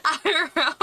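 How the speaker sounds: breaks into real laughter; laughing. Real, not acted. Laughing at redeem voice.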